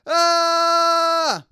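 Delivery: a sudden full-voiced high "ah!" shout; loud yell. Shown as a demonstration. Engineer yelling into the mic to test levels, loud high shout. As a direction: belt out a short yell to peak the meter. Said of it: "if I was to do a really loud clip like that".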